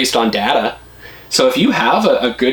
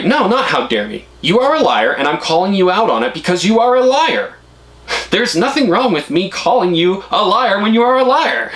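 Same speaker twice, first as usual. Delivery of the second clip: firm confrontation; 8 semitones higher than usual. Retold or imitated. She confronts the salesman, retold.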